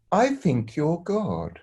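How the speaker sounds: a hint of a wondering child, slow; dazed little boy. Godson tells Stuart he's God, lightly voiced dreamy child.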